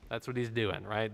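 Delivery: ordinary talk, nothing marked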